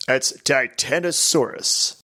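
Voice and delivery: ordinary talk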